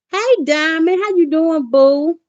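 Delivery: high, bright greeting; cheerful greeting. Real, not acted. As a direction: break off to greet a viewer. Greeting a viewer mid-story.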